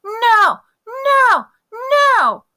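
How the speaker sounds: loud, very high shrieked refusal; screaming tantrum. Acted. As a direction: scream like a toddler mid-tantrum. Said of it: "and he screamed".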